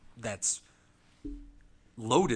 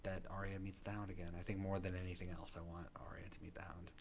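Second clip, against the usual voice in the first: whisper; 3 semitones lower, 13 dB quieter than usual. Real, not acted. Wishes about Arya and the Hound.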